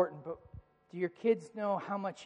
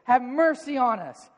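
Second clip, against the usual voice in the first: raised, pleading call, higher and louder; mock crying out; 6 semitones higher, 7 dB louder, slower than usual. Two blind men call to Jesus, loud pleading cry. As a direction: cry out for mercy from the roadside. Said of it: "they start screaming out".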